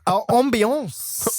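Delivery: drawn-out French-accented "ambiance". Shown as a demonstration. Friend showing off a fancy word, mock French accent. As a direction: put on a French accent, stretching it slowly. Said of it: "that French accent onto it".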